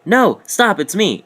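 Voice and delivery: high, loud begging; mock pleading friend. Friend begging not to be attacked, high loud yelp. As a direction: yelp it to stop him. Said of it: "He's like".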